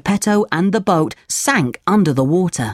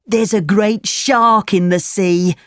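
put-on higher, drawn-out boy voice; tall-tale schoolboy, slow and pitched up; schoolboy, teasing; 5 semitones higher than usual. A narrator's reading. Boy tricks Pinocchio about a shark, drawn-out teasing voice.

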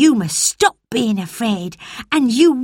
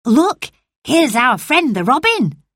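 mild call; 7 semitones higher than usual. Acted. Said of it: "Dickon cried".

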